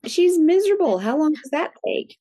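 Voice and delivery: high and loud, indignant pleading; exasperated protest. Retold or imitated. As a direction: retell confronting a brushed-off doctor. Mother exasperated with dismissive doctor.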